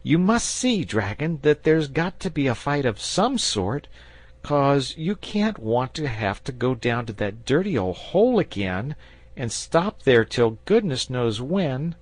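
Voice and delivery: put-on a boy pressing the dragon to fight; boy insisting to the dragon; eager boy. A narrator's reading. Boy voiced high.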